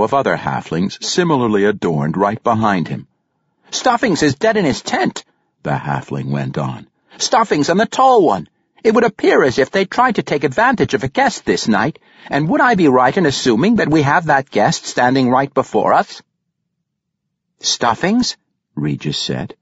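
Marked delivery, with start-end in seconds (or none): 3.7-16.2 halfling warrior. A narrator's reading. Halfling warrior accuses the captors. 17.6-18.4 surprised. A narrator's reading. Regis surprised by nickname.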